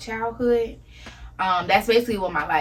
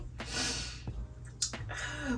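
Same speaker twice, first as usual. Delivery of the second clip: heavy sigh; 8 semitones higher, 12 dB quieter than usual. Real, not acted. Grief over losing her mom.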